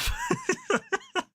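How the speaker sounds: high, giggly laugh at himself. Real, not acted. Laughing at what he said to every group, amused. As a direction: crack up at your own story. Laughs at own retold insults.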